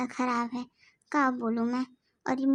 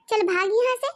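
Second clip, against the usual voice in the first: snapping; 7 semitones higher, 5 dB louder than usual. Acted. Shooing away greedy daughter-in-law.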